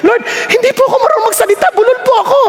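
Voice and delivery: high, fast plaintive excuse; pleading. Retold or imitated. Young preacher telling God he cannot speak, pleading. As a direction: whine it out in a hurry. Retold plea when God called him.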